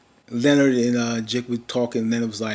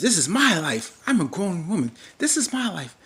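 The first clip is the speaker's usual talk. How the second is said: indignant grown woman; 7 semitones higher than usual. Retold or imitated. Cherry insisting, quoted mid-recap.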